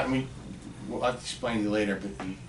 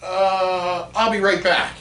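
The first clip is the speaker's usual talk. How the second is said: high, loud mock nonchalance; mock casual backing off; 8 semitones higher, 6 dB louder, much slower than usual. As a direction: play it cool and retreat. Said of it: "I come out like this".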